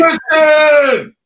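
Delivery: high, loud cheer of her name; excited shout. Real, not acted. Castmate greeting actress as she arrives, excited shout.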